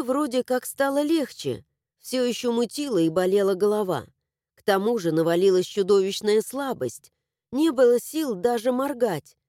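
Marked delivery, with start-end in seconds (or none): none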